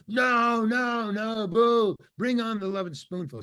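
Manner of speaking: retold yell, voice jumping high; mock crowd heckling. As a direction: boo like a heckler demanding the band. Heckling crowd chanting for band.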